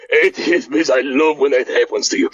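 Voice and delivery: put-on gloating Eastern European accent; Boris voice.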